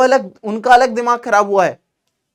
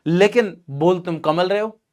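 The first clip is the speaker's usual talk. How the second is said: normal talking voice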